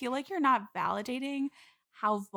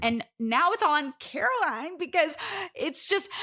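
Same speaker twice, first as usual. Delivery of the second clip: resentful; 5 semitones higher, 5 dB louder than usual. Real, not acted. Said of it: "I'm hearing a little bit of resentment".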